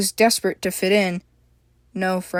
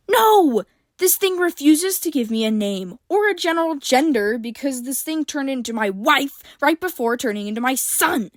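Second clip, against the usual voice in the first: spat angrily; 6 semitones higher than usual. A narrator's reading. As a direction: spit the words in anger. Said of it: "April spat".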